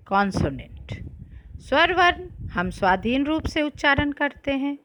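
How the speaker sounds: ordinary delivery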